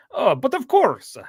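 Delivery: playful mock Russian "Oh, but of course"; Russian accent. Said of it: "when he breaks into his Russian accent".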